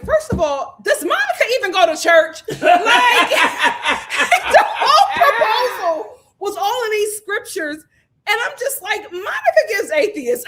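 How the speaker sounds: loud, high, laughing through the words; cracking up while ranting; high laughing disbelief. Real, not acted. Laughing rant about proposal.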